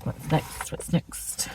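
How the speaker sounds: breathy unvoiced mutter, wondering what's next; whispered to herself. Real, not acted. Crafter whispering to herself while choosing the next piece. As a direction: whisper it to yourself as you pick. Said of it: "muttering to herself".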